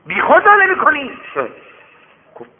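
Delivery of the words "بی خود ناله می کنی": high loud scolding yell; angry shout. Acted. Rich man scolding beggar for moaning, angry shout. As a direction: demand it furiously.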